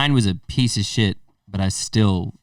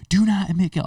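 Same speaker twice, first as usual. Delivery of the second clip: higher, mock adjuster yelling; imitated yell; 9 semitones higher than usual. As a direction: yell it like the adjuster did at you. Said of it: "I remember him yelling".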